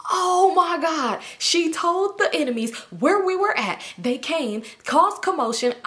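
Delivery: high, slow alarmed realization; panicked thought. Retold or imitated. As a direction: gasp it out as dread sets in.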